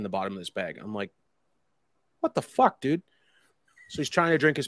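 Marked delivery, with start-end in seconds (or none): 2.2-3.0 shocked disbelief. Retold or imitated. Reacting to friend's bag of loot.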